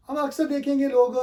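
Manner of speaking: usual speaking voice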